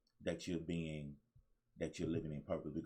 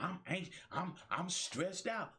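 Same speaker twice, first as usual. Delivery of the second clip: high, whiny "I'm anxious, I'm stressed out"; mock anxious complainer; 8 semitones higher than usual. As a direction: imitate a fretful person, pitched up. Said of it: "A lot of people talking about".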